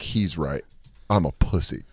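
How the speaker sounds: quietly resigned; dejected mumble. Retold or imitated. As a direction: mutter it, crushed. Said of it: "at the time I was like".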